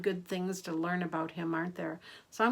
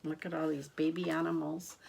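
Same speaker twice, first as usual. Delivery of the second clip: usual speaking voice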